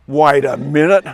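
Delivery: loud, drawn-out outcry of disbelief; acted loud, incredulous protest; dawning realisation. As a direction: call it out as it starts to dawn on you. Kid realises he was fooled.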